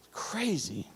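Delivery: breathy unvoiced whisper; stunned whisper; whispered aside. Real, not acted. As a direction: breathe the word out in awe. Hushed aside after the murder.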